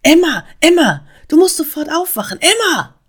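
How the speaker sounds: high, loud wake-up call; eindringliches Rufen. A narrator's reading. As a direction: urgently call a sleeper awake. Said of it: "rief die Stimme eindringlich". (eindringlich und laut rufen)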